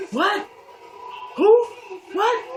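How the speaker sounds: high, sharp rising questions; retold shock. Mother hearing the news on the phone, mock-shocked. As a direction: fire each word upward in mock disbelief.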